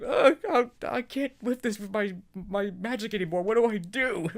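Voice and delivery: high, loud hammed-up panic; comic fake distress; mock panic. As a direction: wail it like a helpless unicorn who can't lift anything. Acting out helpless unicorn.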